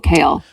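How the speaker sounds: Kale said further back in the throat. Shown as a demonstration. Co-host correcting host, Kale said back in the throat. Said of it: "a little further back in the throat".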